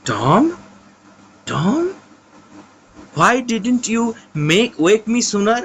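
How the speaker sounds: high, pleading, slow questions; terrified brother asking Tom if he is dying, high and pleading. Acted. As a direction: fear he is really dying. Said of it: "সিড আরো ভয় খেয়ে গেল".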